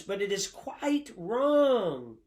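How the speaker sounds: "wrong" stretched long and loud; stress on wrong. Real, not acted. Preacher underlining a quoted verdict for the congregation, "wrong" emphasized. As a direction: draw out "wrong" with a heavy, falling stress. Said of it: "underline that, wrong".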